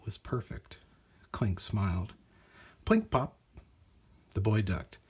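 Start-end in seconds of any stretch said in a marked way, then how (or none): 2.9-3.4 high bright plink pop; robot noises. A narrator's reading. Narrator voicing a part flying off, playful. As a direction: pop it out lightly.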